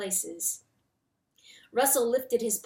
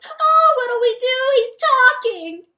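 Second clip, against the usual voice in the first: high, loud panicked mouse shriek; 14 dB louder than usual. A narrator's reading. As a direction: shriek in terror like a tiny mouse. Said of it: "shrieked the little mouse".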